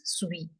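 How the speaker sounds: suis as "swee"; silent final s. Shown as a demonstration. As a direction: say suis with no s at the end. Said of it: "the last letter is not pronounced".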